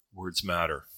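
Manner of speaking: normal talking voice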